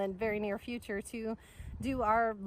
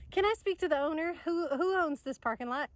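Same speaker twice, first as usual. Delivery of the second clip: eager burst; 6 semitones higher, 3 dB louder than usual. Retold or imitated. Bursting in to ask owners.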